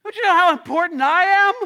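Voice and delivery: a shouting, impatient driver venting; angry road rage. Retold or imitated. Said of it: "I get angry, and I'm like".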